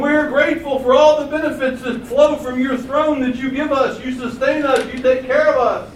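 high, loud acclamations to an emperor; crowd hailing Caesar. Acted. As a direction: cry out praise as a grateful subject. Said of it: "When you came in the presence of Caesar".

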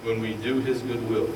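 low, calm recital of hymn verses; hymn recitation. Acted. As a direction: recite the hymn lines gently.

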